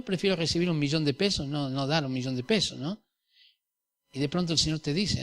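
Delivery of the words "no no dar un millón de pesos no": ordinary delivery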